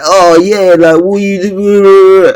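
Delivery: high, slow jeering imitation of the onlookers; mocking gym dudes. Gym onlookers jeer at him, slow high mock taunt. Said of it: "wanna come out like".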